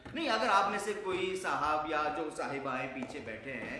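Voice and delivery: ordinary talk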